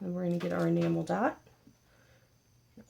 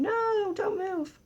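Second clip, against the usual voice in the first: much higher, urgent little plea; pleading squeak; much slower than usual. Real, not acted. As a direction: beg the petal to stay put. Pleading with a slipping petal.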